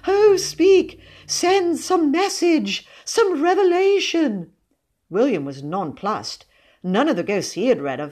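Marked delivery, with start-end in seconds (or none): 0.1-4.5 high, drawn-out rapturous plea; imploring cousin. A narrator's reading. Cousin begging a ghost for a message, imploring. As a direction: beseech the spirit in a high, slow voice.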